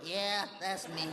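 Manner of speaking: low, slow put-on voice; impression in reply. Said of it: "how to talk like me".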